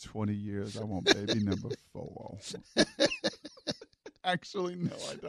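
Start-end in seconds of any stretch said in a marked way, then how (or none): 0.0-2.4 low, very soft lover's murmur; hammed-up hushed romantic gushing; mock sweet talk. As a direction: murmur it low like a smitten husband. Mimicking the gushing love message.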